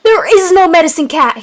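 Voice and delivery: high, loud retort; yelled. A narrator's reading. Leader answering the cry for help, yelling back. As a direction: yell back urgently. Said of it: "he yelled".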